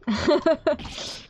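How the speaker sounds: high mocking chuckle; smug villain laugh. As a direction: laugh like a gloating rival.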